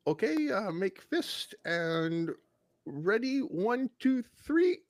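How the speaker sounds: put-on high, slow counting in a nurse's Vietnamese accent; mock Vietnamese accent. Patient imitating the nurse counting down in accent. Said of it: "she's like".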